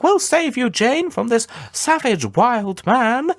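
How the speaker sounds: very high, slow prissy lady voice; mock-heroic high Victorian ladies; mocking rescuers. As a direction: ham up the shocked rescuers. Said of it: "and being like".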